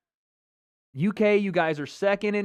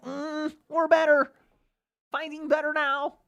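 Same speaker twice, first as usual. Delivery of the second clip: high, nasal-free smug mockery of a fan; 10 semitones higher than usual. Streamer mocking smug UK fans' boast. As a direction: sneer it like a smug fan bragging.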